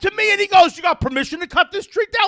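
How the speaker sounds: high, indignant yelling retelling; fired-up shouting. Real, not acted. Tree owner recounts cyclist's permission question, fired-up shouting. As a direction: spit back the question, pitch soaring. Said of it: "I was fired up".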